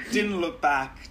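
usual speaking voice

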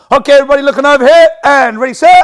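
loud, high, fast call to the class; projected class call. Shown as a demonstration. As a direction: stop the whole class with one call. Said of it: "if you've got the voice to do so".

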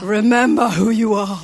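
loud, booming voice from the clouds; resounding fatherly command; lion father. Acted. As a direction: proclaim it hugely, as a ghostly father. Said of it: "his dad goes".

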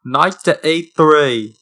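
slow, put-on British accent; drawn-out British chess command; Ron. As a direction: imitate a British schoolboy wizard. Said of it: "like, a Harry Potter one? Like a Ron?".

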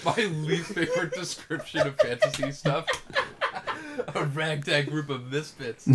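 soft, high, laughing words. Real, not acted. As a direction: speak through giggles. Laughing at blurb joke.

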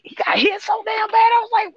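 high-pitched excited yelling; high, incredulous sports-fan holler; worked-up shout. Real, not acted. Reliving a big hit.

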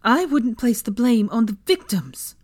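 indignant and forceful; spat. A narrator's reading. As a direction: spit the answer back. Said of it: "Hermione spat at her".

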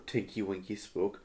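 ordinary delivery

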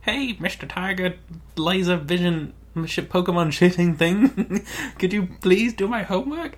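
put-on high-pitched pleading kid voice; little Sally. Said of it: "little Sally going".

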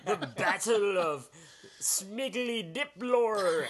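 slow, high drawn-out fantasy name; mock epic. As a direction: say it like ancient lore.

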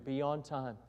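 teacher writes the rule on the chalkboard, murmured; read while writing. Retold or imitated. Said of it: "he got up there".

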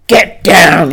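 barked command, loud and sharp; shout. Acted. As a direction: yell it down at them. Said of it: "he shouted".

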